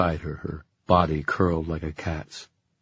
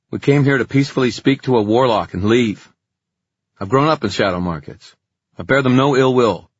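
high, loud pleading warning; urgent defiant boy; 8 semitones higher, 7 dB louder than usual. Acted. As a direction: stand firm and plead loudly. Kit warns the hostile crowd.

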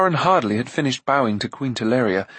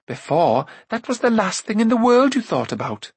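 indignant cry; 9 semitones higher than usual. A narrator's reading. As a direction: pitch rising high in protest. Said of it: "Eilonwy cried".

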